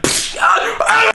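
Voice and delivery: high yelp of pain. Retold or imitated. Man hits his head, high yelps. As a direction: yelp after bumping a head. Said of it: "he's just like".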